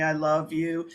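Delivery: low, level monotone; robotic sameness, pitch held still; monotone fake celebrity. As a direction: say it all on one low note. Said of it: "it's not gonna be monotone".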